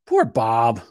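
ordinary talk

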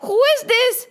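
high, laughing mock panic; startled fright. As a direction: squeal at the lion, half-joking. Messenger startled by lion.

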